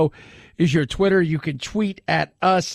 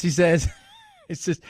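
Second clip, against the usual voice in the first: usual speaking voice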